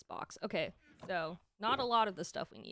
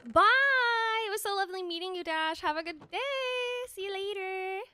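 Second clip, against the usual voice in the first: excited goodbye; 9 semitones higher, 6 dB louder than usual. Real, not acted. Waving goodbye to a departing viewer.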